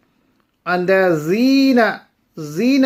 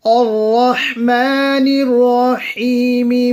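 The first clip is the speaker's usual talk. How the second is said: melodic tajweed recitation, a touch higher; Quran recitation; 5 semitones higher, 4 dB louder than usual. Acted. Teacher reciting the verses for students, melodic and measured. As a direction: chant the verses slowly and evenly. Recites full Surah Fatiha.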